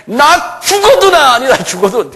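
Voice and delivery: high, loud, drawn-out denial; shouted denial. Acted. Peter swears he is not one of them, shouted denial. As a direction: protest loudly, leaning on each phrase. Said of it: "소리 지르면서 하는 거예요".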